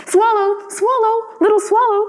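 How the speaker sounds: high, lingering call of the name; a lightly voiced statue prince begging the bird to stay; pleading call. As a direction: call him back gently but urgently.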